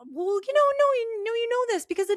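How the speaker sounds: high mock-coaxing friends. Friends insisting she knows the answer, high mock coaxing. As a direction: coax her with exaggerated high insistence. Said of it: "And they're like".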